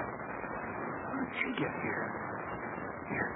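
hushed, breathless whisper. Acted. Sneaking into the enemy room, whispering to himself. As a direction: breathe it so no guard hears.

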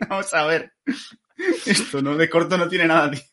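high-pitched mock outrage; excited rant. Real, not acted. Playful rant about the season. (indignación exagerada)

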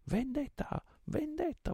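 very high, shouting crowd of pirates; pirates clamoring. A narrator's reading. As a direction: roar for revenge as a mob. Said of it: "vociarono i pirati".

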